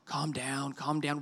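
put-on soft, low, hushed calm down to the kids; soothing parent. Parent hushing panicking kids, soft and soothing. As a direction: settle a child gently, quietly. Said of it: "I say that all the time with my kids".

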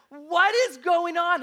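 high, loud, slow, baffled; suddenly lost, almost shouting it; confused outburst. Retold or imitated. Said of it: "I was like".

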